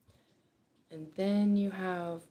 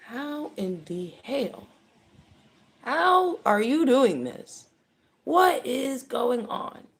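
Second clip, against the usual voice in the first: loud, slow, astonished questioning, pitched higher; baffled disbelief; 7 semitones higher, 9 dB louder, slower than usual. Retold or imitated. Onlooker baffled by someone's success, loud slow disbelief. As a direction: demand to know how it's possible. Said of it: "someone sitting here going".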